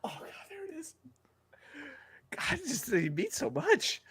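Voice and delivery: high, hushed whimper; choked-up mock crying. Podcaster showing off a tear after impression, mock tearful whisper. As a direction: barely get it out, overcome with put-on emotion. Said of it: "Oh, I see the tear".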